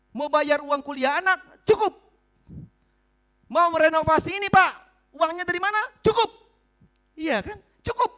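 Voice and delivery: loud preacher with punchy one-word answers; emphatic preaching. Real, not acted. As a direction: call it out to the congregation, loud. Preaches at full force. (berkhotbah lantang dan tegas)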